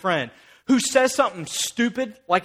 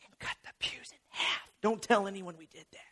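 softer, secretive whispered confession; joking whisper; 3 semitones lower, 14 dB quieter than usual. Real, not acted. Preacher jokingly confides a secret to the congregation, whispering. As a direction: let them in on a secret.

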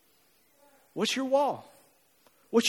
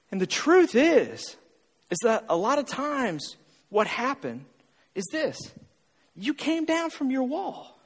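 usual speaking voice

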